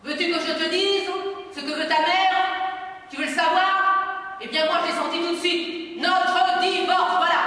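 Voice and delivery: a furious husband taunting his wife; bitter accusation. Acted. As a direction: drag out notre divorce with contempt. Father's bitter outburst at mother.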